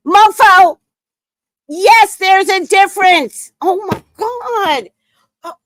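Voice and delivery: high, loud outraged scream; furious yell. Real, not acted. Yelling at the screen.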